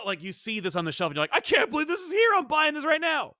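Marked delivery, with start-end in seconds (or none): 1.3-3.3 high-pitched excited gushing; giddy shopper. Pretend. Shopper spotting a dream game on the shelf, giddy excitement. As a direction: gush as if you just found treasure.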